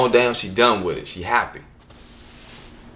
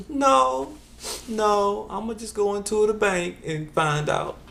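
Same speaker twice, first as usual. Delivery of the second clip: high stubborn mom; 10 semitones higher, slower than usual. Retold or imitated. Mother refuses stubbornly.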